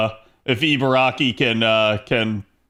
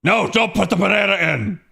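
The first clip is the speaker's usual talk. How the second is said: higher-pitched hammed-up protest; mock yell; 5 semitones higher than usual. Telling a smoothie maker to stop, mock yell. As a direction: play at yelling a frantic objection. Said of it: "did you yell at the person".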